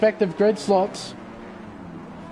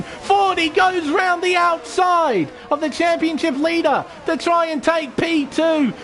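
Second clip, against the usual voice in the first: excited, higher race play-by-play; motorsport commentator; 6 semitones higher than usual. Acted. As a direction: call the race live, pushing the energy.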